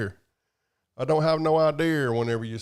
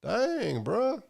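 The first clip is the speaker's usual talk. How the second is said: higher, mock-amazed dang bruh; imitating amazement; 8 semitones higher than usual. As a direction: act stunned by the truck. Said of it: "I was like".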